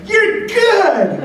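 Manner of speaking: very high, loud excited exclamation; Ted Williams. Retold or imitated. Baseball legend mussing young pitcher's hair, excited high impression. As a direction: burst out with delighted approval, imitating. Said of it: "he gets all excited".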